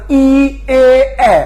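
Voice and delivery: ই, এ, এ as three separate vowels, last one open "ae"; distinct "ee, e, ae" with stretched lips; vowel demonstration. Shown as a demonstration. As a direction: sound out ই, এ, এ for learners. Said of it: "আপনি যদি উচ্চারণ করতে চান".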